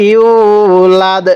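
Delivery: ইউলাদ with the u stretched long. Shown as a demonstration. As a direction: draw out the vowel in ইউলাদ slowly. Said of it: "এই ইয়ায়ের মধ্যে টান হবে".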